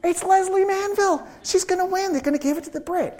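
high-pitched mock panic outburst; retold panic. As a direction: blurt it out high, as if the loss is certain. Retold panicked certainty of losing.

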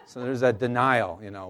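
usual speaking voice

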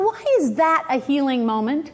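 ordinary talk

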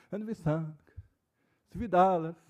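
ordinary delivery

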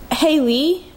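usual speaking voice

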